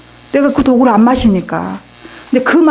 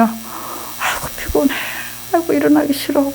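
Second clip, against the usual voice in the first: mock tired whining; 5 semitones higher, 6 dB quieter than usual. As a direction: groan like someone who hates getting up early. Retelling her old morning grumbling.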